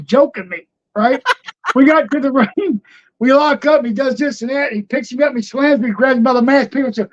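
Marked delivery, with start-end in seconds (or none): none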